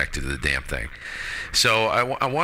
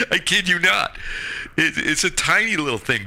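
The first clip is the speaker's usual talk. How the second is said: laughing while talking; 12 semitones higher than usual. Real, not acted. Laughing through size joke.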